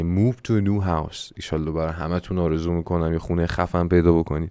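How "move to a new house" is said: very slow, careful teacher reading; slow model sentence. Shown as a demonstration. Teacher models phrase slowly for students. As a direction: read "Move to a new house" slowly. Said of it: "اساس کشی کردن".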